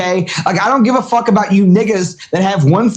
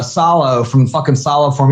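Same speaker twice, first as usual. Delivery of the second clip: usual speaking voice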